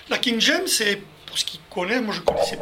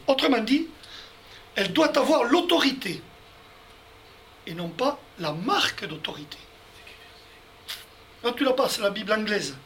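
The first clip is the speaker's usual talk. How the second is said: normal talking voice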